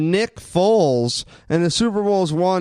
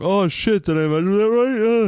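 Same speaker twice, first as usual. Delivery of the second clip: higher, worried self-doubt, "Oh shit"; mock worry; 5 semitones higher than usual. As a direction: play your own late-night panic. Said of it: "I'm like".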